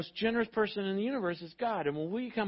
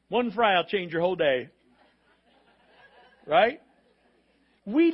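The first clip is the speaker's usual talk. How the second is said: laughing; 7 dB louder than usual. Real, not acted. Laughing after the bit.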